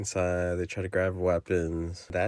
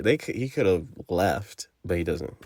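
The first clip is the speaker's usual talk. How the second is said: normal talking voice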